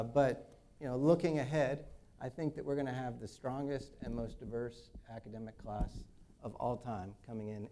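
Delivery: ordinary talk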